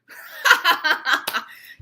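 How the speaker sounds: loud, high forced laugh; fake laugh. Shown as a demonstration. Teacher showing viewers a fake laugh, loud forced laughing. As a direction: burst of staged ha-ha laughter. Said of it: "You can tell that's a fake laugh".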